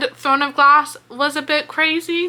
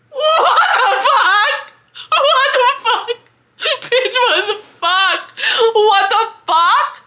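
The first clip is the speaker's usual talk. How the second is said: high, loud, slow what the fuck; stunned, stretch each word out; shocked disbelief; 10 semitones higher, 3 dB louder, slower than usual. Real, not acted. Reader reacting to shocking chapter.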